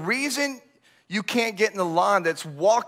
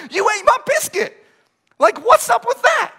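high, louder hurt whine at his wife; hammed-up pitched-up indignant complaint; mock outraged whine; 12 semitones higher, 5 dB louder than usual. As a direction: play it like a wronged kid, high-pitched. Retold biscuit quarrel with wife.

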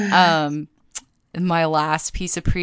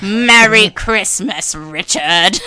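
loud, slow cartoon villain greeting; hammed-up villain wishing season's greetings; Skeletor; 5 dB louder, slower than usual. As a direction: stretch it out in a big villain voice. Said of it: "the voice saying".

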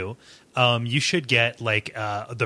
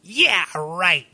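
mock taunt, pitched up at first; game villain; 3 semitones higher than usual. Quoting a demon's comeback to Shaq, mock taunting. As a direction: jeer it like a cartoon villain, then ease off. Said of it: "And he goes".

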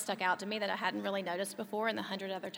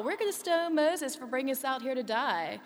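higher, louder panicky imitation; mock freak-out; 5 semitones higher, 5 dB louder than usual. Storyteller voicing the Israelites' constant freaking out, mock panic. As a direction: act out a crowd freaking out. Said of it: "like, constantly freaking out".

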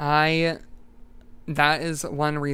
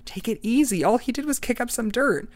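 pitched up, trying to settle him down; a light voiced calmer companion; higher calming voice; 8 semitones higher than usual. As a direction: take it easy, placating. Another hunter calming him.

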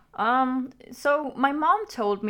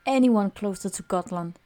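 story narration. A narrator's reading. Reads the story over music.

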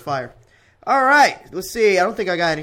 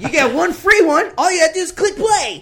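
mock sales pitch; 12 semitones higher, 9 dB louder than usual. Host hyping a joke free podcast offer, mock salesman. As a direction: pitch it like an over-the-top giveaway.

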